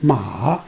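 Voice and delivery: drawn-out "Ma", down low and then up; third tone. Shown as a demonstration. Teacher showing the third tone, "Ma" dipping then rising. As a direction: dip "Ma" deep before lifting it. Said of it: "coming down first and then go up later".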